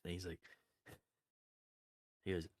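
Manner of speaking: ordinary talk, nothing marked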